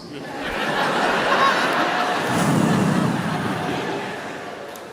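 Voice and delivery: long high wheezing laugh; breathless cackling laugh; big laugh. Real, not acted. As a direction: crack up helplessly at the joke. Comedian cracks up at own bit.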